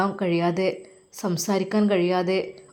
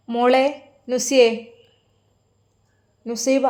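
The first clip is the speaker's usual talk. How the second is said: mother calling her daughter loudly across the house; raised voice calling from another room; 5 semitones higher, 3 dB louder, slower than usual. Acted. Mother calls out to her daughter in the next room, loud.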